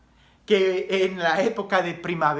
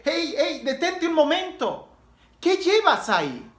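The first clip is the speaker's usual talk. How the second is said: higher-pitched "hey, stop" to someone passing; calling to stop; 7 semitones higher than usual. Acted. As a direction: halt a passer-by and question him. Priest stops boy to question him.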